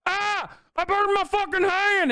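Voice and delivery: very high mock cry of pain; hammed-up kid howling over a burn; bad kid yelping. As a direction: yelp it out in a high, childish voice. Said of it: "like a bad kid".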